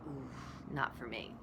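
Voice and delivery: retold scared refusal. Student refusing spiritual yoga, hushed and mock-scared. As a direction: back away from it, quietly. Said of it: "Like, I was scared".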